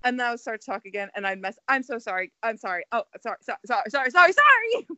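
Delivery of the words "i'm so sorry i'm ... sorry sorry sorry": rising, faster, louder flurry of sorries; frantic apologizing. Retold or imitated. Retelling her flustered apologies to the interviewer, frantic. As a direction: panicky mock apology spiralling up.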